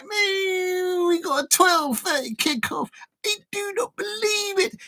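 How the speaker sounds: high wailing fake sob of a fan; mock crying fan. Liverpool fan crying over twelve thirty kickoff, high mock wail. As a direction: wail it like a heartbroken supporter. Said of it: "They'll be like".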